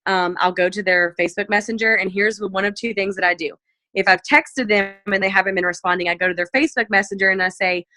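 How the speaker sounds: ordinary talk, nothing marked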